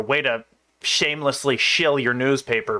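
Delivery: usual speaking voice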